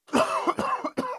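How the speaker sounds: harsh coughing fit. Real, not acted. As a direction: cough hard, choking on it. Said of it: "It was choking me".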